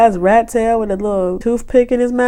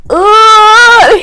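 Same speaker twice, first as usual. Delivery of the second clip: mock loud groan; 11 semitones higher, 8 dB louder than usual. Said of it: "He's like".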